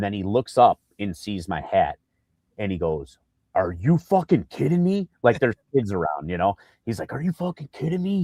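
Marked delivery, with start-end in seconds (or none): 3.5-5.1 high, amazed outburst; shocked and thrilled; excited disbelief. Retold or imitated. Retold excited player outburst. 7.1-8.2 high, amazed outburst; excited disbelief. Retold or imitated. Same quote repeated higher.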